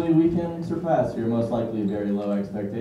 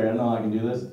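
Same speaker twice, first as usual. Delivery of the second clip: ordinary delivery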